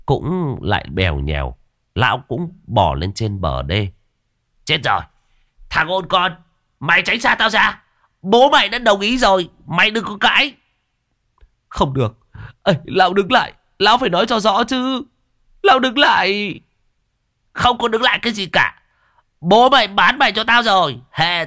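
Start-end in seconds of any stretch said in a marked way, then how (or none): 4.6-10.5 put-on old master shooing. Old master shoos apprentice. 11.7-16.6 apprentice calling after. Acted. Luận chases the old man.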